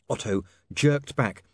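usual speaking voice

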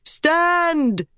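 high, long-held call to troops; rallying cry. A narrator's reading. Queen to her fleeing soldiers, rallying cry. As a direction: cry it out high, falling at the end. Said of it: "Elaine cried".

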